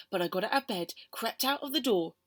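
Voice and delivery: plain reading voice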